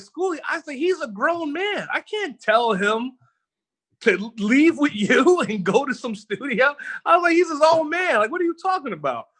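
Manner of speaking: imitating himself begging Schoolie, voice climbing up; exasperated disbelief. Retold plea to Schoolie.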